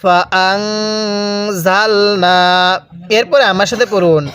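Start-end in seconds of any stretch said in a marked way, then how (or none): 0.0-2.4 ফাআনজালনা very slow with a held nasal hum on the n; nasal ghunnah. Shown as a demonstration. Teacher demonstrates ghunnah on ফাআনজালনা, very slow. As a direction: hold the নুন with ghunnah through the nose, slowly. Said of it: "হ্যাঁ, কিভাবে পড়ব?".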